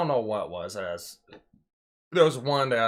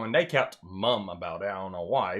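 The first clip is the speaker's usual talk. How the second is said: ordinary delivery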